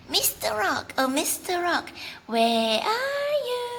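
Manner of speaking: the bunny calls back over his shoulder, far up and teasing; calling bunny voice. Acted. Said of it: "looks behind".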